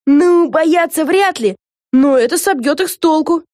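earnest high-voiced remark; perfectly serious. A narrator's reading. Lucy assesses the name against pirates, quite serious. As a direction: weigh it up in all seriousness, voice high. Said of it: "совершенно серьёзно заметила Люси Тетти".

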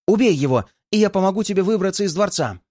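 срывается на высокий крик; выпали быстро, на крике. A narrator's reading. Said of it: "Срывается на крик". (быстрый высокий крик)

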